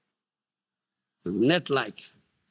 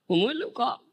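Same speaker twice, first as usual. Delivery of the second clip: ordinary talk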